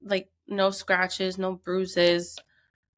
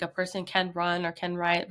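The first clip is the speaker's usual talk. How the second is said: ordinary delivery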